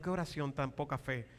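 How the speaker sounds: rueful thought kept under the voice; inward remark. Retold or imitated. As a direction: drop it down like a private thought. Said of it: "yo decía para mí adentro".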